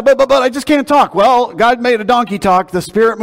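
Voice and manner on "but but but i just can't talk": higher, louder stammered objection; mock a reluctant listener's stuttering protest; protesting excuse. Preacher voicing a reluctant church member's excuse, stammering protest. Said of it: "You said".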